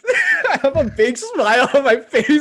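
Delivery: talking through real laughter; grinning and laughing while speaking. Real, not acted. Laughs at a friend's story.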